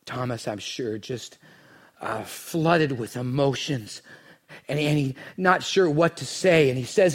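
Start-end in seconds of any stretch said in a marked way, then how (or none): none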